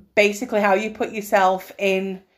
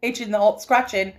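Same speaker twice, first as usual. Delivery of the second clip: fast incredulous rant; rapid mock disbelief; faster than usual. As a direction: rattle it off in disbelief. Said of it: "He's like".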